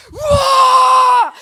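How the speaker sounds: big high shout, jumping out of hiding; startled scare yell. Retold or imitated. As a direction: yell to scare a friend from the closet.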